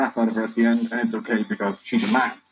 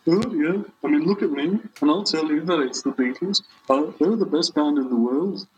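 higher, put-on Beatle impression; [name]; 4 semitones higher than usual. Guest praising his own band in character, as a higher-voiced Beatle impression. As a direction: pitch it up and lilt like a famous Beatle. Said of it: "you can do a good poll".

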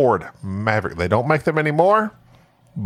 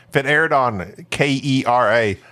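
ordinary delivery

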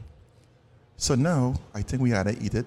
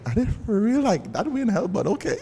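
incredulous; 8 semitones higher than usual. Retold or imitated. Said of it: "and I'm like".

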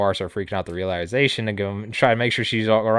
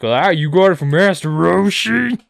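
put-on much higher, louder and slow, a booming old king; 8 semitones higher, 7 dB louder than usual. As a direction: boom it out like a big old king. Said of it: "That sound like Steve?".

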